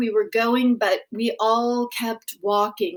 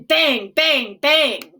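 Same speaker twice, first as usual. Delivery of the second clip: rising, louder, gunshots burst out; loud gunshot retelling; 3 semitones higher, 5 dB louder, much slower than usual. A narrator's reading. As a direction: bang it out loud and urgent. Gunshots retold loudly.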